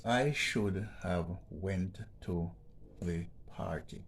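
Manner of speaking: slow, careful reading of "I should have went to the party"; slow read-out of the sentence. Shown as a demonstration. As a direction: read the example sentence slowly. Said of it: "It reads like this".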